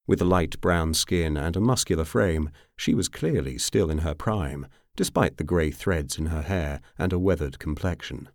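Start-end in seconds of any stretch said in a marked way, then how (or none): none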